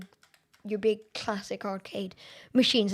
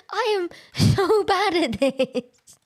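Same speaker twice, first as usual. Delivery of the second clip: high, loud wail through a laugh; slow, stretched-out cry breaking into laughter; laughing despair; 7 semitones higher, 5 dB louder, slower than usual. Real, not acted. Laughing at own poor play.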